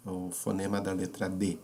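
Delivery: ordinary delivery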